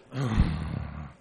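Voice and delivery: sighing for the world's woes; audible sigh. Acted. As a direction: let out a deep, audible sigh. Congregation sighs over world woes.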